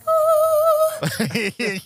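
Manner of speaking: squeaky high voice-changer squeal. Retold or imitated. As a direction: squeal like a cheap voice disguiser. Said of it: "But you sound like".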